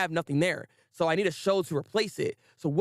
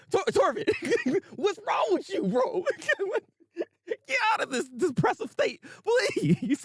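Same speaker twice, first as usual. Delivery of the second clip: high, giggling disbelief; laughing plea. Retold or imitated. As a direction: beg him through laughter. Speaker scolds Thorfinn, laughing.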